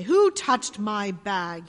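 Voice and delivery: slow, loud bellow. A narrator's reading. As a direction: roar it out slowly. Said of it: "he bellowed".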